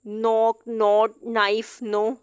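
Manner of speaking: नॉक नॉट नाइफ नो, slow, no k; silent k words. Shown as a demonstration. Teacher drills the silent k words slowly. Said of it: "तब के silent".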